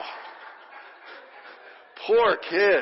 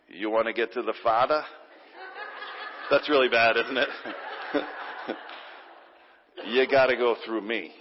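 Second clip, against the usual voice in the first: put-on Italian accent; 7 semitones lower, 3 dB quieter than usual. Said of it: "he probably had an Italian accent".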